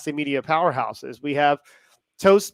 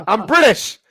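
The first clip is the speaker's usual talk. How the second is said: put-on British accent; 3 semitones higher, 5 dB louder than usual. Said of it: "He is not British".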